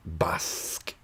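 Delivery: slow breathy whisper. Real, not acted. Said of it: "whispered aside".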